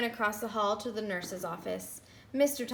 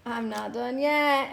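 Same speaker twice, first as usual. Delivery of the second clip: usual speaking voice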